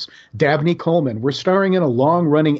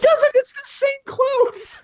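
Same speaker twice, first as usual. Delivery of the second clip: high squeak; slower than usual. Acted. Mocking exasperated repeat.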